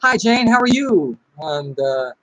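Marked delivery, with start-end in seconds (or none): 0.0-1.2 Tom's greeting. Retold or imitated. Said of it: "Tom speaks up and he goes".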